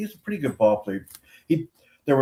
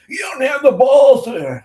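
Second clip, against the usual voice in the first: loud, much higher mocking yell; taunting shout; 13 semitones higher, 10 dB louder than usual. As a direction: yell the dare right in his face. Player taunting the umpire.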